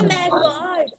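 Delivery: high, loud "Oh my God!"; teacher acting shock for the class; shocked exclamation. Shown as a demonstration. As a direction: gasp it out in shock. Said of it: "teacher models exclamation".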